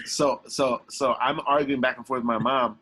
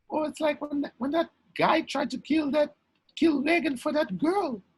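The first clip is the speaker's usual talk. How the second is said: mom's voice. Retold or imitated. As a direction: drift into it like a mom thinking aloud. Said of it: "She goes".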